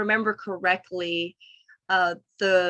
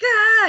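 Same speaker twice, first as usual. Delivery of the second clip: short high yelled ah, louder; mock scream; 13 semitones higher, 10 dB louder than usual. Imagining screaming out her anger. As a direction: let out a quick imitated scream. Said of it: "I wish I could scream".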